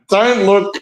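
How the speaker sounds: ordinary talk, nothing marked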